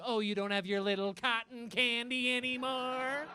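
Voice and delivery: drawn-out, teasing mock-mom voice; mocking mother. Mother to child quitting vaping, mocking. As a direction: coo it mockingly like a belittling mother. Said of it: "You could tell your own mother".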